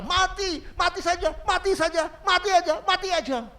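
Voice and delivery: high, loud retold scream of despair; patient in agony screaming for death; screaming mati. Sick man screaming to die, high and loud. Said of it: "dia teriak apa".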